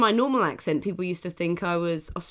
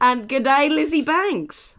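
mock Australian accent; 6 semitones higher, 4 dB louder, much slower than usual. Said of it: "We're getting worse".

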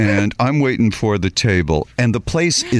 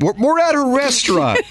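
exasperated; 12 semitones higher than usual. Retold or imitated. Exasperated reply to mother.

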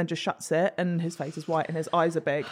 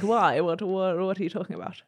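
posh hunter. Retold or imitated. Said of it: "Really posh, like a hunter".

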